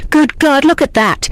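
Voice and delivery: high, loud startled cry. Acted. Servant cries out at the sight of the body, high and loud. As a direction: shout it out in shock. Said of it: "and crying".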